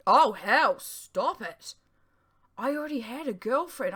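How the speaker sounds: loud burst at herself; self-scolding outburst. A narrator's reading. Narrator telling herself off, loud burst. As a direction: snap out of the daydream.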